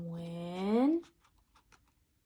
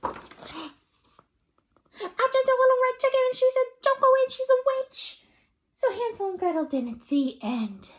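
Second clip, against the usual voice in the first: high, loud and fast, bursting in; blurting out the warning in a rush; excited chick interruption; 7 dB louder than usual. Acted. Little chicken bursting into Papa's story, high loud excited voice.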